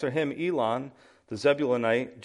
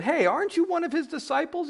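high-pitched mock servant girl; put-on high voice, questioning; faster than usual. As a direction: pipe up accusingly as the servant girl. Servant girl accuses Peter.

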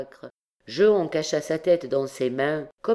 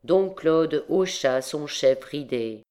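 plain reading voice

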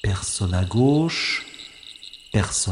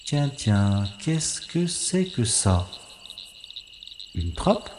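curious surprise; 5 dB quieter, slower than usual. Acted. Spy notices a strange hatch on the lawn, intrigued. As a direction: draw it out, intrigued by the find. Spy spots something odd.

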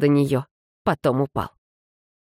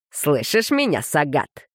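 plain reading voice

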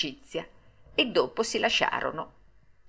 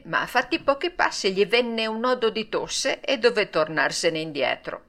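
ordinary delivery, nothing marked

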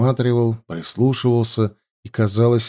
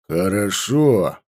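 grudging; 3 semitones lower, slower than usual. A narrator's reading. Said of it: "процедил похититель".

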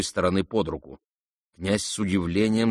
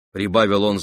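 usual speaking voice

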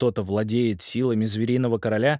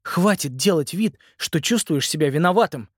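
sharp; 6 semitones higher than usual. Acted. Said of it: "резко сказал он".

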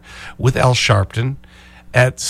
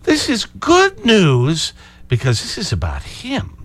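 slow, higher mock-pundit voice; mock gloating; 7 semitones higher than usual. Host lightly parodies Fox hosts, slow and higher. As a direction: parody the smug commentary lightly. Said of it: "the comment last night".

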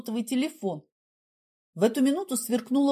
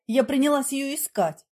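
ordinary delivery, nothing marked

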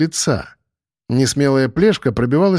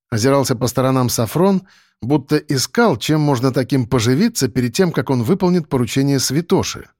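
ordinary delivery, nothing marked

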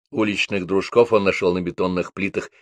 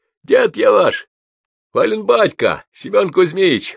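put-on much higher, louder old man announcing himself; proud grandpa; 11 semitones higher, 5 dB louder than usual. A narrator's reading. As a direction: boom out who he is, proudly. (гордый дед, высоко и громко)

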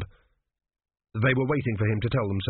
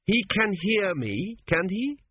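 put-on higher, slow fussy old king's voice; an old king's ghost pleading, pitched up; 9 semitones higher, slower than usual. A narrator's reading. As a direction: play the dead father, urgent and high.

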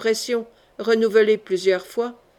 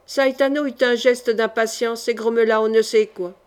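plain reading voice

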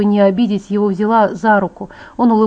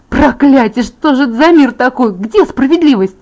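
loud, higher-pitched railing; outraged outburst; 4 semitones higher, 5 dB louder than usual. A narrator's reading. Girl rails at a world without justice, outraged. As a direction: fling the questions out in indignant fury. Girl rages at unfairness of ageing.

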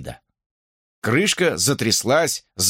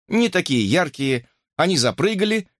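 usual speaking voice

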